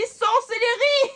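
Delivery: loud, high, drawn-out cry; shocked exclamation. Retold or imitated. Mother crying out on hearing the story, loud and shocked. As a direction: shout it out in horror. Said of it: "Ma mère me dit".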